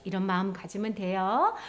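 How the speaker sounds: ordinary delivery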